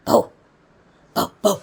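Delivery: a light, playful woof woof; dog bark. Shown as a demonstration. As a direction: bark gently for the toddler. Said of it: "പട്ടിയുടെ sound".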